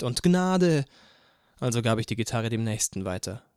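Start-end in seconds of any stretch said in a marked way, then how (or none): 0.2-0.8 loud, high plea; calling out. A narrator's reading. A few listeners beg off an encore, calling out. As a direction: cry out for mercy in jest. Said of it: "ein paar Leute riefen".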